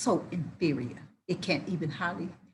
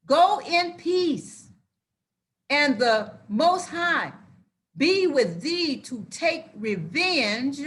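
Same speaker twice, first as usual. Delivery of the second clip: rising preacher's call, loud and slow; preaching; 7 semitones higher, 5 dB louder than usual. Acted.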